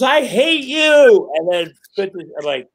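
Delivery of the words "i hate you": high, loud, very slow pout; mock teen tantrum. Boss mimics the actress storming off in a high drawn-out pout. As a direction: drag out a sulky teen's hate. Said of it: "She goes".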